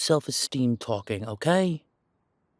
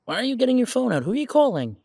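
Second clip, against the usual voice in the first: alarmed surprise; 8 semitones higher, 4 dB louder, faster than usual. Acted. Partner pulls out a phone.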